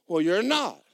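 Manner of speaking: put-on emphatic contradiction; retold insistent devil. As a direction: argue back like a frustrated devil. Devil argues back in story.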